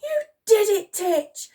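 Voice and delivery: high, excited yell. A narrator's reading. Girl cheering the soldier, yelled. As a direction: yell your congratulations. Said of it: "yelled Elsie".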